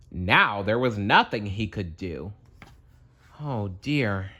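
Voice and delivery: usual speaking voice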